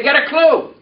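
calling out the line for echo; preacher's call line; congregation repeat-after-me. Shown as a demonstration. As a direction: lead the response loudly. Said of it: "Look at your neighbor and say".

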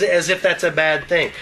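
normal talking voice

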